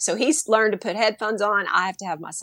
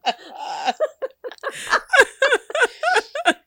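big high-pitched burst of laughter; 3 dB louder than usual. Real, not acted. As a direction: laugh out loud at yourself. Laughs after quote.

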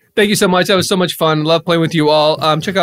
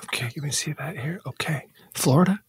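whispering; 9 dB quieter than usual. Acted. Bouncer checks ID quietly.

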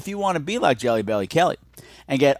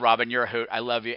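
ordinary talk

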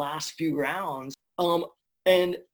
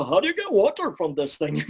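replayed Ed line; 4 dB louder than usual. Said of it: "I'd hear this".

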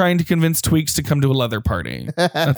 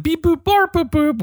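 high beeping droid noises; R2-D2 beeps; 12 semitones higher than usual. Retold or imitated. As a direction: chirp like a little robot droid. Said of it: "Because it's like".

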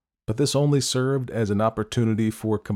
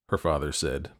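usual speaking voice

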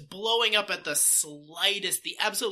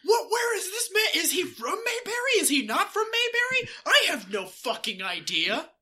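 shocked confusion; 12 semitones higher than usual. Retold or imitated. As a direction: react in total bewilderment. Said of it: "they would have been like".

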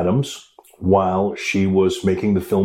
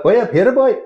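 Cork accent; 13 semitones higher, 6 dB louder than usual. Shown as a demonstration. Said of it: "it's like this high-pitched sing-songy thing".

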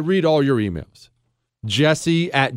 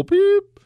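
much higher, sharp beep sound in place of a swear; mock censor beep; 4 dB louder than usual. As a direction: bleep the word out with your voice.

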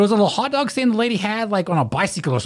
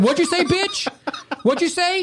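mock confrontation; 12 semitones higher than usual. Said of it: "I said".

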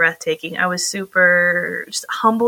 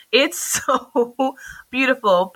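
laughing; 5 semitones higher, slower than usual. Real, not acted. Gushing about Wales, laughing.

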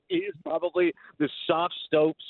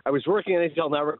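normal talking voice

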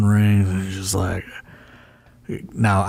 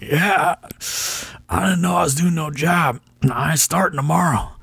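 put-on high, stubborn old wrestler refusing to lose; Tommy Rich impression; 8 semitones higher than usual. Tommy Rich refusing to lose the match, high stubborn impression. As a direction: pitch it up and dig in, I don't do jobs. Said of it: "my Tommy Rich impression was pretty good".

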